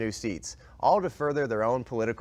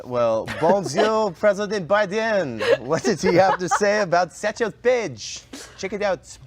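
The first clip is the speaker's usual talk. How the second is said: loud, slow, higher hammed-up bonjour intro; hammed-up announcer; 7 semitones higher, 6 dB louder, slower than usual. Host introducing the Biden clip to cohosts, hammed-up loud intro. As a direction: announce the clip with mock grandeur.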